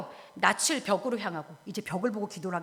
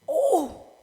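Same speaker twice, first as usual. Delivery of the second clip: high, loud mock groan; anguished groan; 8 semitones higher, 6 dB louder, much slower than usual. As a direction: groan out in acted exasperation. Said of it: "이러고 또 안 나와요".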